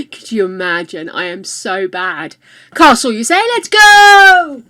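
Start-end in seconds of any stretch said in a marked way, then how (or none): 2.7-4.6 high, loud eager cheer; rally everyone, thrilled; excited. Real, not acted. Excited about building a castle.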